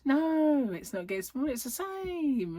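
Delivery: high, drawn-out mock contradiction; imitated dismissal. Partner denies it is getting smaller, mock dismissive drawl. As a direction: stretch it out in sing-song disagreement. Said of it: "and then Gary goes".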